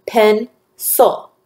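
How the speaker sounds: "Pencil" in two separate beats, pen, cil; split into syllables. Shown as a demonstration. Teacher repeating the syllable count, "Pencil" split in two. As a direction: break "Pencil" after pen with a short pause. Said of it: "I hear two syllables in the word pencil".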